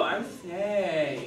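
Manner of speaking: slow, mocking repeat; mocking snake impression. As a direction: drag it out, teasing the snake attempt. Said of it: "Snakes go, not, they don't go".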